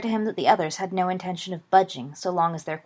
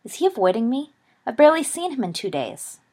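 impatient; 6 semitones higher, 5 dB louder than usual. A narrator's reading.

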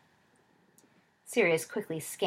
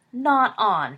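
loud, indignant protest; a loud protest at Peter's bet; indignant cry; 4 semitones higher, 9 dB louder than usual. A narrator's reading. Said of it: "cried James".

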